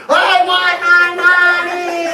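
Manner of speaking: slow, loud, high wail. Acted. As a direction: bawl it out slowly and loudly. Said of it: "He just cried louder".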